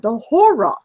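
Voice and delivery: lightly voiced yenta sigh. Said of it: "I did my, uh, Yenta voice there".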